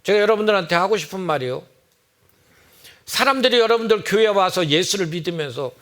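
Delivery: usual speaking voice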